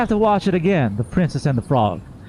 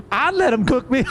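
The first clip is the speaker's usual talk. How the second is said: quoting a friend; 9 semitones higher than usual. Retold or imitated. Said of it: "Pauline went like".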